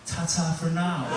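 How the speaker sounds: slow, soft posh English "Ta-ta for now"; mock-posh goodbye, drawn out; a hint of posh English accent; posh sign-off. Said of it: "I hung up the phone by going".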